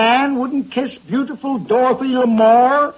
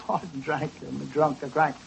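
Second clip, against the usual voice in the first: muttered self-correction; 8 semitones lower, 11 dB quieter than usual. Real, not acted. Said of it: "the cook had drank".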